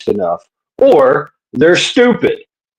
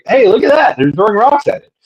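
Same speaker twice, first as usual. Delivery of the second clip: higher, mock-delighted watcher's call; a scientist on Earth gleefully pointing at the screen; amused mission control; 7 semitones higher than usual. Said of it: "at Earth going".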